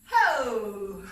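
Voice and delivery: loud, long "ho" sliding downward; falling "ho" whoop. Shown as a demonstration. Dancer throwing the hat at the final pose, loud whoop. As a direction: let out a big falling whoop.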